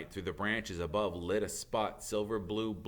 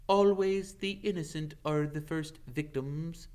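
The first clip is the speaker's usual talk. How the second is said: a hint of a grave old centaur; 7 semitones higher than usual. Ronan voiced.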